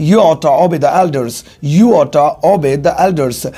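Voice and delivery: ought to slurred to oughta, said slowly; slow demo of oughta; slow casual contraction. Shown as a demonstration. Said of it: "short में बोलेंगे".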